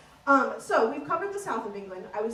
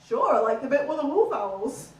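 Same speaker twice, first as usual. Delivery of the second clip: louder, unbothered Yorkshire fella; Yorkshire accent; 6 dB louder than usual. Retold or imitated. Yorkshire man unmoved by the questioning, in a Yorkshire accent. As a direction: repeat it placidly in broad Yorkshire. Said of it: "Northern is quite a laid-back accent".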